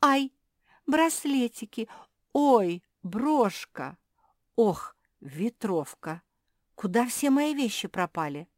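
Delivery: high, loud little cries; alarmed dismay. A narrator's reading. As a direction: gasp out each missing item in dismay. Discovering her things have vanished.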